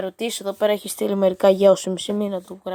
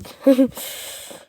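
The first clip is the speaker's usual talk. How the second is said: burst of laughter; 5 semitones higher, 3 dB louder than usual. Real, not acted. Laughing at bot's name reply.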